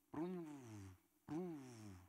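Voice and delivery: soft, falling "prum" engine noise; imitated engine sputter. Coasting down many days, soft imitated engine noise. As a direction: imitate a motor failing to catch. Imitating motorbike that fails to start.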